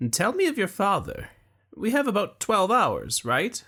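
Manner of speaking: cling to hope for your friend; young Luque, desperate. A narrator's reading. Young man begging his dying friend, high and urgent.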